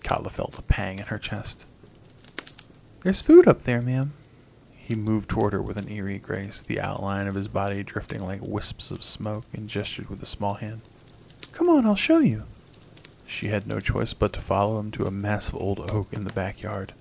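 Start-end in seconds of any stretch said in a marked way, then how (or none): 3.0-4.1 put-on high, eager little boy voice; a small boy, hopeful and loud; ghost child. A narrator's reading. 11.5-12.4 put-on high, eager little boy; a small boy leading the way; ghost child. A narrator's reading.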